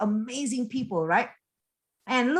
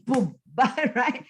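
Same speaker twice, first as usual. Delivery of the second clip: ordinary talk, nothing marked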